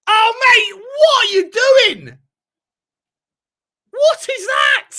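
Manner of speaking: high, loud, slow disbelieving shout; outraged yell. Real, not acted. Outraged at a play.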